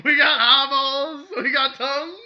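loud, high manic salesman; unhinged villain. Pretend. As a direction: cackle the offer like a lunatic. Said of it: "We both sound like madmen".

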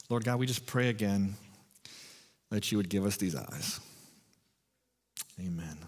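hushed closing prayer. Real, not acted. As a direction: pray quietly, with long pauses. Leads a short prayer.

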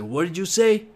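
a touch of an angry heckler's challenge; mock angry heckler. Comic imitating offended pub heckler, mildly angry. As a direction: retell the heckler's offended bark, lightly. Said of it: "he's pissed off".

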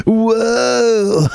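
high, long-held spring breaker "whoa"; high-pitched party-kid amazement. Acted. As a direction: stretch it out like an astonished spring breaker. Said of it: "that's your impression of a spring break".

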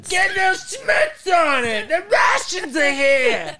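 high, loud, drawn-out Bronx screech; Italian Bronx woman. Acted. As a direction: scream like a hammed-up Italian Bronx mom. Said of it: "I could do that voice".

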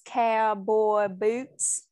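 loud, very slow Southern drawl; prolonged-vowel twang. Shown as a demonstration. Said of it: "prolonging, like, the vowels".

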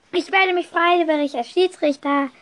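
höher und lauter gerufen, aber kein voller Schrei; in die Menge gerufen. Retold or imitated. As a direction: eifrig in die Menge rufen. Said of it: "schrie ich in die Menge". (mäßig lauter, höherer Ruf in die Menge)

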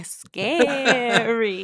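slow spooky drawn-out ghost; cartoon ghost voice. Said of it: "I'm trying to sound like a cartoon ghost".

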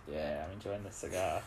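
low, quiet movie-villain voice. Acted. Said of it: "that sort of stereotypical bad guy".

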